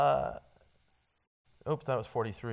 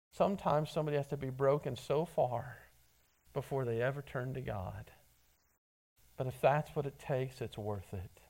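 hushed sincere; 6 semitones lower, 8 dB quieter than usual. Real, not acted. Pastor to congregation about the broken, hushed and sincere. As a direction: drop much softer and lower, earnest. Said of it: "quiet sincere aside".

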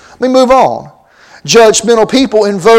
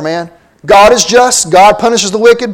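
ordinary talk, nothing marked

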